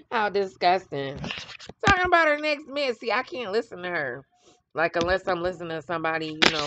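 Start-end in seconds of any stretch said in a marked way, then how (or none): 1.8-2.9 high, fast mocking repeat; scoffing, higher-pitched sneer; mocking echo. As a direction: echo it back with scorn. Mocking the comment she read.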